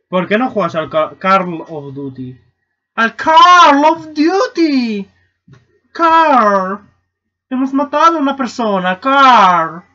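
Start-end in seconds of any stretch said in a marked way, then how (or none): 3.0-5.1 loud, high, long-drawn cry; hammed-up outcry, much higher and slower; mock shout. Shouted, drawn-out echo of question. 5.9-9.8 loud, high-pitched hammed-up outcry; overacted horrified scolding; mock scolding shout. Acted outcry to Carl.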